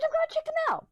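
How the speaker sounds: very high put-on mom voice; high mock voice of mom announcing her plan. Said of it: "She goes".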